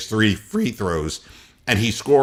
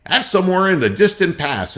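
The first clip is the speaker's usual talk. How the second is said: ordinary talk, nothing marked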